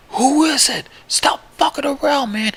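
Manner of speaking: high-pitched, frantic yell; yelling outside. Acted. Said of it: "We keep yelling outside".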